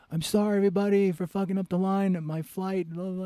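high, loud public apology; loud apology to crowd. Retold or imitated. Apologizing to the whole security crowd, high and loud. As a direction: project to hundreds of people, sorry but rushing. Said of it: "I'm telling the whole crowd, hundreds of people".

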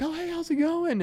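cheery Southern hello; friendly Southerner. Retold or imitated. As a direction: greet a stranger like an old friend. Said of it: "they're like".

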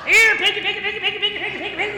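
very high, rapid squealed calling; hog call. Shown as a demonstration. Preacher calls pigs for the kids, high squealed hog call. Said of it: "I might try one this morning".

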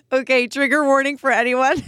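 giggly disclaimer between laughs; amused, breaking up; laughing warning. Real, not acted. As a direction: warn them while trying not to laugh. Warns listeners while laughing.

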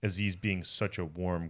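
ordinary talk

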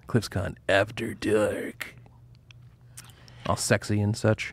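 slow, soft, seductive murmur; sexy voice. Shown as a demonstration. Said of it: "that's my sexy voice".

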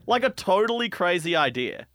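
ordinary delivery

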